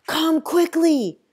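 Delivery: slow, loud and high shout; desperate shout across the water; urgent call. A narrator's reading. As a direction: holler for him to hurry. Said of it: "I call out".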